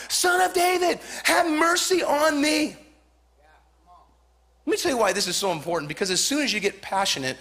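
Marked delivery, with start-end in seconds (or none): 0.1-2.8 high pleading cry, far higher. Acted. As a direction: cry out for mercy to Jesus. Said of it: "passionately to scream out".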